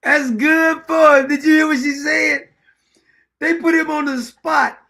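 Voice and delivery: high and loud, delighted; gleeful loud reaction to the clip; excited cheer. Real, not acted. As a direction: cheer her on, loud and thrilled.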